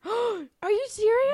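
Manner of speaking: gasp, then a loud, slow disbelieving question; astonished and thrilled; gasping surprise. Real, not acted. Surprised by four countries.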